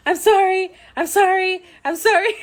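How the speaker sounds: high, laughing flustered sorries; flustered apologies. Retold or imitated. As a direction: apologize frantically while bumping people. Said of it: "And I just keep".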